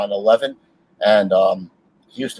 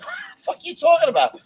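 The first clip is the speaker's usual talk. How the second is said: high, fast, incredulous; mock disbelief at her; high fast what-the-hell; baffled; faster than usual. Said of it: "I'm like".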